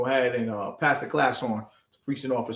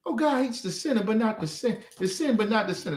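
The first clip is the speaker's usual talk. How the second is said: higher-pitched parroted church saying; mocking mimicry; 7 semitones higher than usual. Preacher quoting believers before calling it a lie, mocking mimicry. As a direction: parrot the saying in a raised, dismissive voice.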